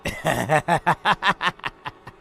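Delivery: long menacing demon cackle; evil laugh. Acted. Demon laughs at Seema's pleas, evil cackle. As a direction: laugh at the victim's pleading. Demon mocks the begging girl.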